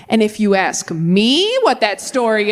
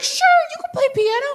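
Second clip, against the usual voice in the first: church adults; 8 semitones higher than usual. Retold or imitated. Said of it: "they're like".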